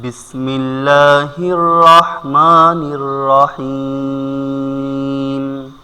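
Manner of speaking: slow melodic Arabic recitation; Quran recitation. Real, not acted. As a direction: recite with long drawn-out vowels.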